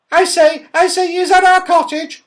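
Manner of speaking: very high, loud squeal of surprise and delight; baby bear squealing with joy; little bear thrilled to see home, shrieking. Acted. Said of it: "shrieked the baby bear in surprise and delight".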